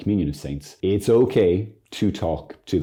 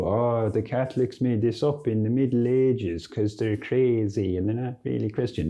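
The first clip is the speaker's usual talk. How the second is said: mocking critic; 5 semitones higher than usual.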